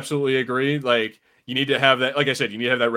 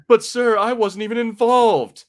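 whiny officer quote; 10 semitones higher, 4 dB louder, slower than usual. Retold or imitated. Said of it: "And then later on".

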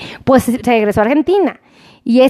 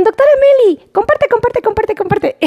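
excited fan imitation; 9 semitones higher than usual. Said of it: "me ven y me dicen".

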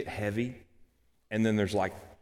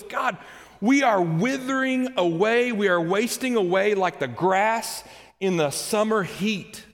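high, loud lament to God; anguished outburst; 10 semitones higher, 5 dB louder, slower than usual. Acted. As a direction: wail out a complaint to God, easing toward the end. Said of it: "Moses has a little outburst here".

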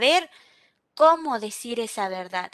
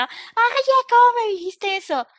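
higher, louder mock offended snap; imitating someone who takes offence, loud and high; offended outburst; 10 semitones higher, 6 dB louder than usual. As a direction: jump up indignant at the remark. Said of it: "ahí sí saltamos".

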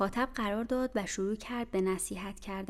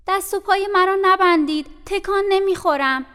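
high, loud pleading cry; frantic shout; 10 semitones higher, 12 dB louder than usual. Acted. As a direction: scream it, begging not to be tied.

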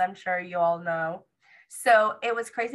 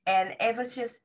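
ordinary talk, nothing marked

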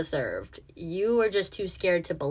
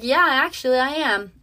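cocky answer; 5 semitones higher, 5 dB louder than usual. Retold or imitated. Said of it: "I was like".

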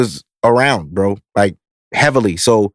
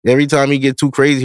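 ordinary talk

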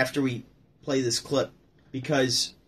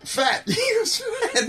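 laughing; much slower than usual. Real, not acted. Cracking up over the joke.

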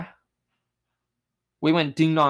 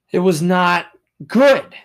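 high and loud, punching the word; emphatic exclamation; 5 semitones higher, 8 dB louder, slower than usual. Real, not acted. Telling viewers how bad the lockout was, loud high outburst. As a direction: belt it out in disbelief.

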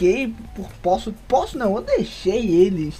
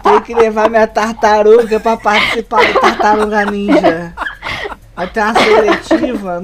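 louder, laughing through silly excuses; 7 dB louder than usual. Real, not acted. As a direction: joke your way out, laughing.

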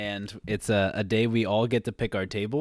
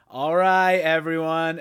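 loud, higher call to the whole room; teacher calling out; 4 semitones higher, 7 dB louder, slower than usual. Retold or imitated. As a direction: call the lunchroom to attention like the teacher. Teacher calls the lunchroom to order.

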